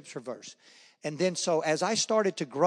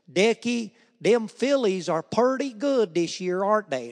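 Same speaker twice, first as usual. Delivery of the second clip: put-on higher, louder, slow drawled brother's accent; old home accent; 5 semitones higher, 5 dB louder, slower than usual. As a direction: imitate his brother's thick down-home drawl. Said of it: "Did I used to talk like that?".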